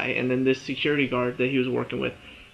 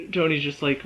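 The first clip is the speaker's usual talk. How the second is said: usual speaking voice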